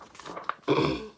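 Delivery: short throat clear. Real, not acted. Narrator pausing to clear throat.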